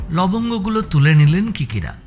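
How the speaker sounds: plain reading voice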